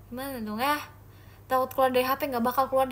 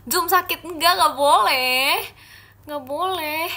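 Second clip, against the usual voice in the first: high, loud, drawn-out no; playful protest; 5 semitones higher, 7 dB louder, slower than usual. Real, not acted. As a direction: protest loudly, stretching the words. Protesting at chat's suggestion. (protes dengan suara tinggi)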